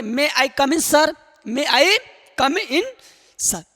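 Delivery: May I come in सर spelled out slowly, a pause before सर; slow drilled phrase. Shown as a demonstration. Teacher drilling May I come in for students, very slow. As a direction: drill it for the class. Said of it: "तो क्या बोलोगे?".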